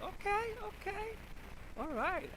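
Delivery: okay okay, high and hyped; impressed, grooving along; excited. Retold or imitated. Reacting to dope tracks.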